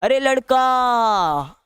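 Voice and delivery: "अरे लड़का" stretched slowly, vowels held three times long; triple-length call. Shown as a demonstration. Teacher demonstrates a prolated vowel, calling a boy from afar. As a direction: call "अरे लड़का" across a distance, dragging each vowel. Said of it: "ऐसे एकदम तीन गुना समय लेके बोल रहे हैं".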